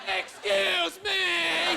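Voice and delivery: slow, high mocking Excuse me; mocking her. Man mocking the lady's Excuse me, slow and high. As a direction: parrot her Excuse me with a sneer. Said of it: "I wanted to mock her".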